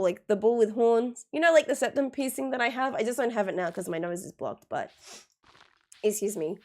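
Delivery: normal talking voice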